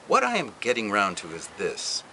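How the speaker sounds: plain reading voice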